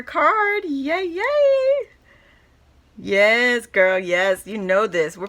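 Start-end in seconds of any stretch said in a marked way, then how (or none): 0.0-1.9 high, loud squealing yay with a laugh; giddy cheer. Real, not acted. Vlogger squealing with joy over her friend's card.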